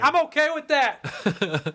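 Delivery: high, slow, laughing mock voice; mock pushover. Mocking neighbors who accept the fee, high and slow. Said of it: "And I'm like".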